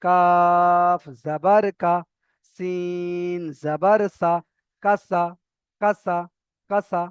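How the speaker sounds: long "কাফ" and "সিন", then short repeated "কাসা"; repeated drill. Shown as a demonstration. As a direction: recite slowly, then drill the joined word. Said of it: "দুবার তিনবার করে বলে নেবেন".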